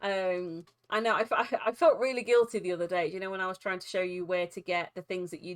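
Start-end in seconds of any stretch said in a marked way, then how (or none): none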